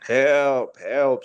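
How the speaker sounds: usual speaking voice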